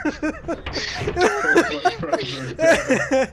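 laughing through the words; cracking up while saying it. Real, not acted.

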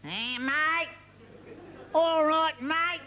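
put-on high Australian accent, rising challenge; aggressive Australian. Australian challenges his mate to fight, belligerent Australian accent. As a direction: goad them in a broad Australian accent. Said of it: "The Australians".

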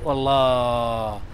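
"والله" ممدودة ببطء شديد وهو يفكر; تردد ممطوط؛ a very slow drawn-out wondering. Real, not acted. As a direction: stretch the word while thinking. يتردد في الاختيار.

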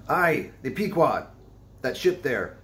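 gruff old seaman, hammed up; a weathered sailor pointing. A narrator's reading. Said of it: "drawing back his whole arm".

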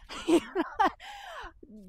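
high-pitched laugh at own mock yell; amused high laughter. Real, not acted. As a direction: let out a long, high laugh. Laughs after own exaggerated demonstration.

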